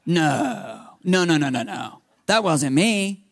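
emphatic imitated denial, rapid run of no's; acted flustered denial. Screamer denying it to her friend, acted insistence. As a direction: protest as if wrongly accused.

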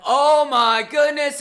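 slow, loud, high "oh my goodness"; amazed gasp. Retold or imitated. Churchgoer stunned by a new preacher, loud and amazed. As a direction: let the wonder pour out slowly. Hearing amazing preaching for first time.

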